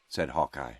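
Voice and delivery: usual speaking voice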